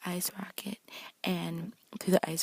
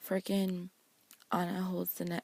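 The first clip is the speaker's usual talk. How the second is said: normal talking voice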